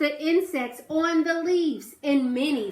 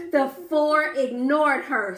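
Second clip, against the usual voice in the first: plain reading voice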